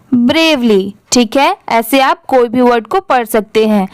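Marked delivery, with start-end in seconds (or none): none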